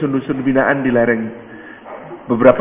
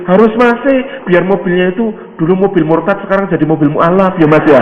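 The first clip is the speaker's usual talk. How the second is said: high, eager persuasion; insistent urging; 6 semitones higher, 4 dB louder than usual. Acted. Villager urging him to buy the car, insistent. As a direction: press the point in a raised, animated voice.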